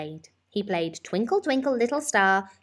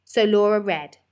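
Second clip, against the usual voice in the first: plain reading voice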